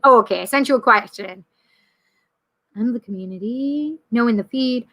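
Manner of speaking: ordinary delivery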